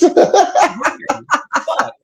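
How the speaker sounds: laughing curse. Real, not acted. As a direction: crack up while swearing. Reacting laughing to story.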